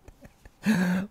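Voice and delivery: short high laugh; real laugh. Real, not acted. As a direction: let a loud giggle burst out. Laughs again at the gossip.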